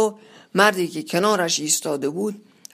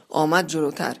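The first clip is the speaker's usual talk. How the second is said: usual speaking voice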